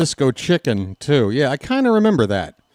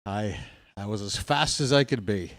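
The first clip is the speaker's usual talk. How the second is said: out of breath; 6 semitones lower, 8 dB quieter than usual. Real, not acted. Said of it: "That's why I'm out of breath".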